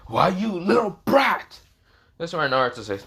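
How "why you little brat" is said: high, drawn-out villain outrage; seething cartoon villain; angry Orochimaru. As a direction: stretch it out in hammed-up anger. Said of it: "Orochimaru's angry and says".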